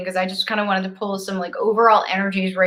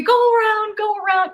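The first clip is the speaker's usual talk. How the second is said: high-pitched mock yell at drivers; frantic urging, pitched way up; retold yelling; 12 semitones higher than usual. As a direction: imitate yelling from behind the wheel. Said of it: "yelling at the people in front of me".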